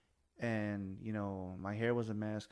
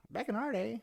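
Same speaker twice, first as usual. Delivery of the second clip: put-on pitched way up, teasing old-timer tone; high mock old-timer; 12 semitones higher, 4 dB louder than usual. Shown as a demonstration.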